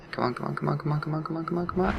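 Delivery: rising, pleading come on; tense whispered urging. Real, not acted. Urging a shot to land.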